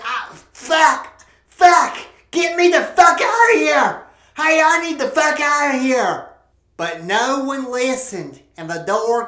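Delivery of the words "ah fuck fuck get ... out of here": high, loud panicked cursing; panicked yell. Acted. Trapped man yelling at the crowd in a panic.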